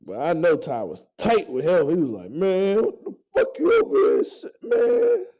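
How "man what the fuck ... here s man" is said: mock upset friend. Ty voiced, upset in story.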